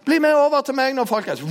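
loud high call to the crowd. Retold or imitated. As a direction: summon everyone across the mountain. Prophet calls the crowd over.

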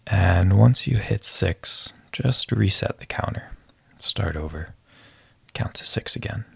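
calm breath-counting guide voice; guided meditation. Acted.

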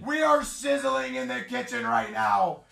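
ringing, excited boast; hype announcer. Real, not acted. Streamer celebrating a hot streak, hyped call. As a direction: crow it to the whole room.

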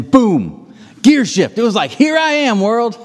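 high, punchy mock announcement; exuberant outburst. Bagger telling shy Leo how she came out of her shell, exuberant. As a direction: burst out high as if announcing yourself to the world. Bagger recounts bursting out of shyness.

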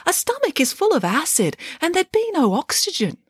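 high little piglet reasoning. A narrator's reading. Piglet argues with mother about wolf's stomach, high piglet voice. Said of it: "said her surviving piglet".

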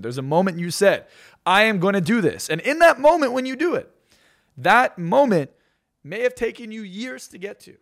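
fired-up motivational climax; rising passionate emphasis. Real, not acted. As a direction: preach it, building to a peak of conviction. Speaker building to a passionate peak.

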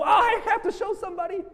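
high, fast, bursting to share; can't wait to show a friend; eager excitement. Retold or imitated. Preacher imitating someone sharing a viral video, eager and fast.

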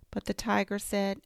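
usual speaking voice

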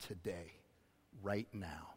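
soft whisper to the hurting; whispered right to those in the storm. Real, not acted. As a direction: lean in and breathe it. Intimate appeal to hurting listeners.

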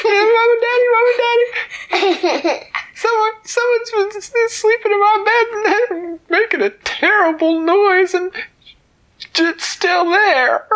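very high, loud frantic cub; a cub calling his parents urgently; squeaky high look-look chatter; excited baby bear. Acted. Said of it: "Baby Bear said".

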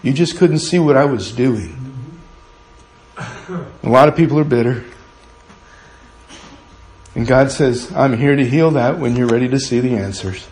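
loud, ringing pulpit preaching; full-force preaching. Acted. Pastor preaching boldly to the congregation, loud and fervent. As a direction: preach it out to the whole church.

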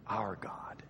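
drops to a soft whisper; hushed, reverent close. Acted. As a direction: let the vow fade into a breath. Hezekiah's defiant vow fades out.

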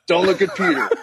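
laughing inner voice. Retold or imitated. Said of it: "I was like".

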